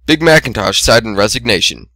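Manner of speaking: usual speaking voice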